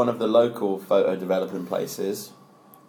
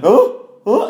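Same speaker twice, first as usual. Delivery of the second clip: high, louder startled "oh, oh"; 12 semitones higher, 8 dB louder than usual. Retold or imitated. Mimicking the woman realising she's on the wrong train, startled. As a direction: caught out all of a sudden.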